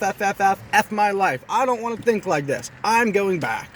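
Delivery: husband replaying his blowup at his wife, angry; angry outburst. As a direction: go off on her in a heated rant. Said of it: "Very anger, angry".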